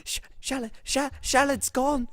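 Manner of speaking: put-on high, stammering old lady in shock; distraught Grandma Shallard. Grandmother reports Shallard missing, distraught.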